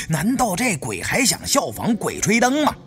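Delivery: usual speaking voice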